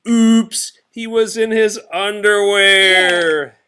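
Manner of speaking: high, loud and drawn out; comic reveal. A narrator's reading. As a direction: land the embarrassing punchline big.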